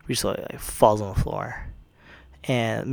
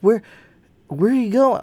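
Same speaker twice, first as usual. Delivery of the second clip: high mock "where are you going"; startled question; 10 semitones higher, 5 dB louder than usual. Retelling the hero asking where she goes, high. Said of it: "he goes".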